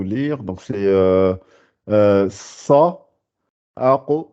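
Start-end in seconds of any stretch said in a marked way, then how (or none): 1.9-4.3 saqrun very slow with every sound heavy. Shown as a demonstration. Teacher slowly models the heavy saqrun. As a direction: saqrun drawn out, everything puffed up. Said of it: "ça c'est gonflé".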